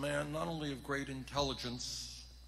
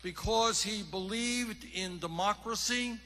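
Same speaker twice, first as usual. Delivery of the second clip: loud, fired-up stump speech; rally speech; 5 semitones higher, 10 dB louder than usual. Acted. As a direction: rouse the crowd from the podium.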